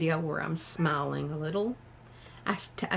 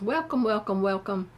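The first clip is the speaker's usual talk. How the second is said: imitating a chirpy host; 3 semitones higher, 6 dB louder, faster than usual. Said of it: "and she goes".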